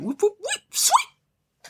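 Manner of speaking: very high, drawn-out slide-whistle whoops; high comic sound effects; slipping noises. Retold or imitated. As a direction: voice a cartoon slip on a wet floor. Imitates kid slipping on water.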